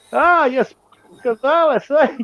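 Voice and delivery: high, hammed-up cartoon Mexican voice; mock Speedy Gonzales. Teasing the Mexican rig seller on the phone, high hammed-up cartoon voice. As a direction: ham it up like a cartoon mouse.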